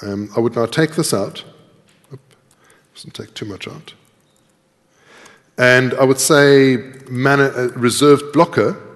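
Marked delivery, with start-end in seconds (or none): none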